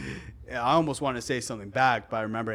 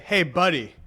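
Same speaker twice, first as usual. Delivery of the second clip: Hey, buddy. said flat and pointed, buddy pressed down; a patronizing warning tone; condescending buddy; 5 semitones higher, 4 dB louder than usual. Shown as a demonstration. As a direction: talk down to him. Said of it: "It's all about the tone of voice".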